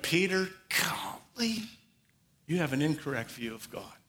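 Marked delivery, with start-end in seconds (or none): 0.0-1.6 whispered, slow, let-down sigh of a name; a weary whisper of disappointment; disappointed Jesus. Pretend. Preacher mocking a wrong view of Jesus, whispered disappointment. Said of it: "Jesus looked at Peter and went".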